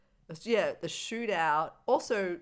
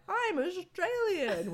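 exaggerated Australian, much higher and slower; mock Australian; 11 semitones higher, much slower than usual. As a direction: drawl it like a cartoon Australian. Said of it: "embarrassing to me as an Australian".

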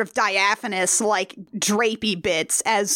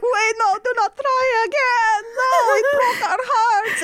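wailing brides; 12 semitones higher than usual. Retold or imitated. Brides pleading with Dracula, hammed-up high wail. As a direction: overplay the brides begging in tears. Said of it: "the brides are like".

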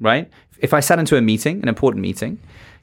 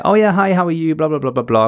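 bright, higher automatic hallway hello; breezy greeting; 6 semitones higher than usual. Retold or imitated. Colleague greeting a coworker in the hallway, bright and breezy. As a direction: toss off a quick autopilot greeting. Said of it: "Think about when we would meet in the hallway".

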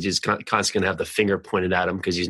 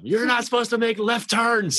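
much higher-pitched retold yell; high, exasperated mock holler at a driver; mock scream; 13 semitones higher than usual. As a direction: scream it in outrage, without real volume. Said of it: "I'm screaming at him".

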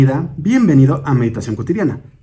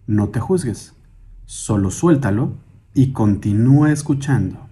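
calm, measured meditation guide with long pauses; guided meditation; 4 semitones lower than usual. Acted. Guide asking listeners to sit, breathe and hear their surroundings, guided meditation. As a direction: lead them gently through breathing and listening.

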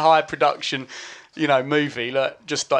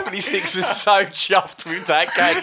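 laughing; 4 semitones higher, 4 dB louder than usual. Real, not acted. Laughing at a friend's joke.

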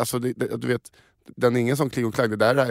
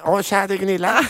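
high-pitched comic mother voice; imitated mom; 6 semitones higher than usual. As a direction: put on the same high voice for mother. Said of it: "din mamma och Hasse Brontén". (ljus, tillgjord mammaröst)

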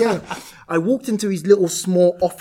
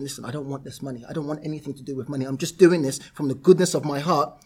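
ordinary talk

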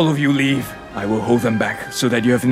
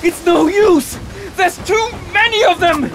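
high, alarmed outcry; panicked high voice in combat; desperate battle cry. Acted. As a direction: call out that all is lost. Men losing battle to skeletons.

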